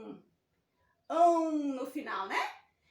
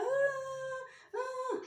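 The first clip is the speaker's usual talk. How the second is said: mock sobbing ah; 8 semitones higher than usual. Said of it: "E ela continuou a chorar".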